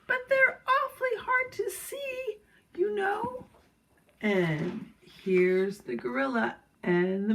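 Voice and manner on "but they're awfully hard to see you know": very high, plaintive little monkey voice. A narrator's reading. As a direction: squeal it as a tiny monkey. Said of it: "said the six little monkeys".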